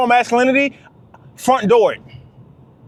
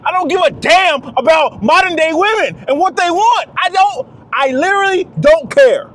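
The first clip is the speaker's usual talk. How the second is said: raised, higher, louder ranting; heated shout; 8 semitones higher, 3 dB louder than usual. Real, not acted. Ranting to the fellas, shouting that he doesn't care. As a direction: shout it out, fed up and defiant. Heated rant about modern women.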